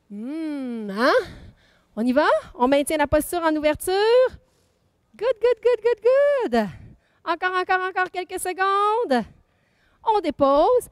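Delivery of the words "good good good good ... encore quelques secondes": excited cheering. Real, not acted. Coach cheering class through hold.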